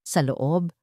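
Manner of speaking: usual speaking voice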